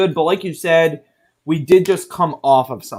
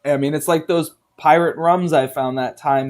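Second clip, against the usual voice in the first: ordinary talk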